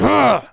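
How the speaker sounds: mock angry grunt. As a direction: huff in mock anger at the phone. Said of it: "you get angry at your phone".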